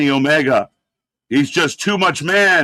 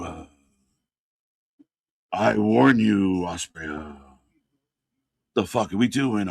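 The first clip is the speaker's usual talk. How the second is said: breathy Triple H; 8 semitones lower, 4 dB quieter than usual. Acted. Host mocking wrestler's promo at All In, breathy low impression. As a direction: drop low and soft, breathing out between phrases. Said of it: "Why are you so breathy? Is he trying to be Triple H?".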